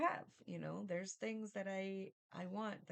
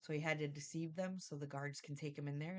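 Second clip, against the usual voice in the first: ordinary talk, nothing marked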